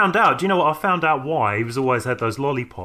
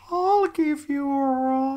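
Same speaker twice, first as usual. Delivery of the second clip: long, high, drawn-out wail; eerie quote; 13 semitones higher, much slower than usual. Acted. Recalling a favourite story for co-host, high drawn-out quote. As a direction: stretch it out on a high held note.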